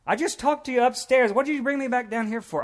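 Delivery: high, loud, baffled complaint; indignant protest. Acted. Preacher plays Lazarus called back down from heaven, indignant bafflement.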